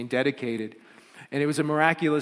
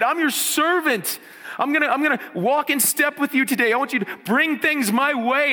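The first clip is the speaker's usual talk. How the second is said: higher, louder, pleading with full conviction; fervent prayer; 9 semitones higher, 7 dB louder than usual. Pretend. Pastor voicing a believer's prayer to the Lord, fervent and loud. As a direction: pray it out boldly, all in.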